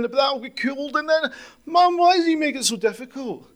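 high, loud, wailing complaint; breaking down. Retold or imitated. Daughter breaking down to her mum over dinner, high and wailing. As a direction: wail it out in despair over the counter. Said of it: "she breaks down over the counter".

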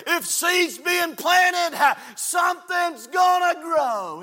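fiery pulpit shout with stretched-out key words; full-force preaching. Real, not acted. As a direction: drive each phrase home to the congregation.

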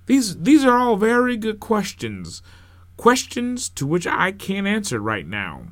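calm a questioning crowd, stumbling at the start; projected, slightly flustered preacher; flustered minister. Acted. Flustered minister answers the congregation.

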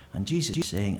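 ordinary delivery

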